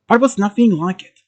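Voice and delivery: ordinary delivery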